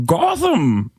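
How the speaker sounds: hammed-up supervillain voice; Bane. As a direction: grandly announce it as a movie villain. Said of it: "Bane is my favorite".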